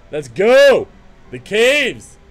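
loud, rallying call; eager shout. Real, not acted. Gamer charging into the caves, loud eager shout. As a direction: fire yourself up to charge in. Rushing into next level.

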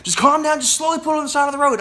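high, loud, rushed retold order; urgent instruction. Teacher to panicking learner, high, loud and hurried. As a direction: try to calm someone while sounding frantic.

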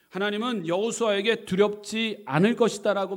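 loud preaching emphasis; emphatic repeat. Real, not acted. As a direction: drive the point home with force. Preacher repeats point forcefully.

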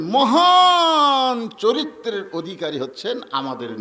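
high, loud, long-held opening word; preacher's exalted proclamation. Real, not acted. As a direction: proclaim it loudly, stretching the first word. Preacher proclaims God's praise of Prophet. (উঁচু গলায় টেনে মহিমা ঘোষণা)

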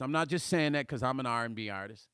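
ordinary talk

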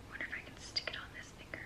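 quick, very soft whisper to yourself; whispered musing. Real, not acted. Trying a ring on her finger, hurried whisper to herself. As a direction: whisper it under your breath, quickly.

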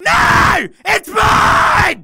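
put-on loud childish squabbling; bickering shouts. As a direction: fight like siblings over the bed. Kids fighting over top bunk.

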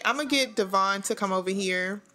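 ordinary delivery

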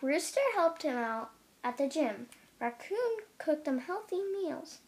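usual speaking voice

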